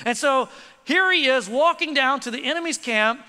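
ordinary talk, nothing marked

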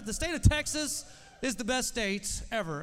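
hyped shout. Real, not acted. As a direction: sell it like a cheer. Pastor hyping the crowd about Texas.

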